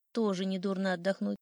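ordinary delivery

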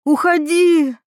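high, loud outburst of anger; angry mother's cry. A narrator's reading. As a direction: cry out to drive someone away. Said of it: "крикнула мать".

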